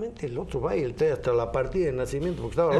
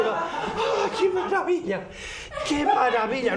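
delighted laugh; 11 semitones higher, slower than usual. Acted. Wife delighted in joke.

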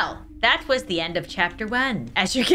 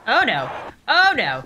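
normal talking voice

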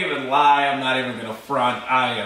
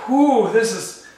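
emotional; 7 semitones higher, 4 dB louder than usual. Real, not acted. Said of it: "hitting me really hard in the feels".

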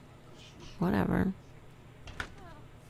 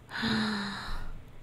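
soft gasp; 6 dB quieter than usual. Real, not acted. Reacting to the sim's death.

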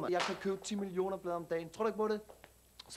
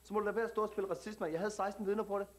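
put-on street slang Danish accent, cocky; perkerdansk accent. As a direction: talk like a tough kid from the suburbs, perkerdansk accent. Same immigrant youth character. (selvsikker perkerdansk accent)

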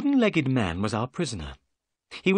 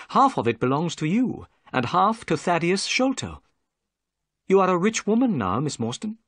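narrator speaking; 7 semitones higher, 3 dB louder than usual. A narrator's reading. Narrator's dialogue.

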